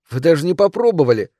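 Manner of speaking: put-on high, loud child's reproach; protesting little boy. A narrator's reading. Mother recalling her son's reproach, indignant.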